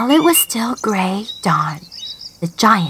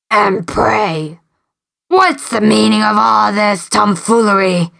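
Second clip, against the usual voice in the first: gruff storybook giant; 2 semitones lower than usual. Acted. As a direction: demand an answer like a giant. Giant questions princess.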